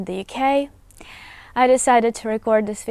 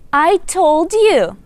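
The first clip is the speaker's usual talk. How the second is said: I told you louder, higher and slow; emphatic I told you; 7 semitones higher, 7 dB louder, slower than usual. Shown as a demonstration. Teacher translating the scolding line, loud and high. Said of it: "One more time".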